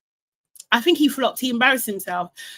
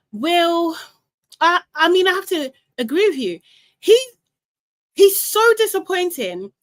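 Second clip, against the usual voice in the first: higher, louder so disappointing; emphatic agreement; 6 semitones higher, 4 dB louder than usual. Real, not acted. Host agreeing with viewer Will, emphatic. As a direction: stress your frustration.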